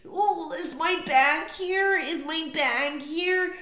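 high, loud, slow whiny mimic; mocking oblivious traveler. Mocking people bent over the carousel, high whiny drawl. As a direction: drag it out like a clueless passenger. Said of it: "if you're all bending over".

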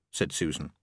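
plain reading voice

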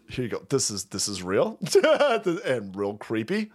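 ordinary delivery

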